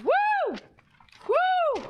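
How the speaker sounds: very high whooping cheer. Real, not acted. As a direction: whoop like a party crowd. Cheering after frantic auction run.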